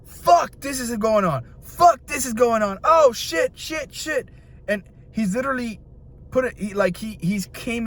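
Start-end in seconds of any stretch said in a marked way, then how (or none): 0.0-4.3 high-pitched frantic exclamations, acted out; panicked executive. Film fan acting out a studio boss hit by crises, panicked. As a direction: play an overwhelmed boss as everything goes wrong. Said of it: "every time he does it, it's like".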